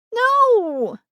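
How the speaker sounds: put-on high shrill child's yell. A narrator's reading. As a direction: yell it like a tantrum. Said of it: "yelled Danny".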